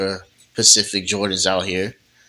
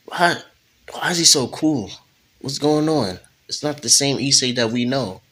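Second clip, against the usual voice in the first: higher-pitched, puzzled disbelief; mock bafflement; 6 semitones higher than usual. Puzzled friend wondering aloud why he is so cool, baffled.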